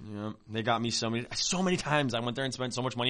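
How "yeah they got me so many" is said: ordinary talk, nothing marked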